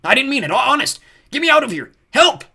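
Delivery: high, loud goblin begging; panicked cries for help; frantic pleading. A narrator's reading. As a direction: beg desperately, building to a yell. Goblin pleading in griffin's beak.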